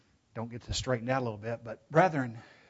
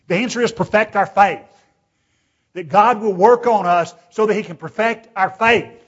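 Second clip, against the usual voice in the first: loud, raised-pitch pulpit delivery; full-force preaching; 6 semitones higher, 15 dB louder than usual. Acted. Preacher warning the congregation about deliberate sin, full-force preaching. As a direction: drive it home with rising intensity.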